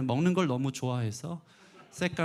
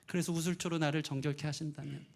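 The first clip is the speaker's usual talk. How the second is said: normal talking voice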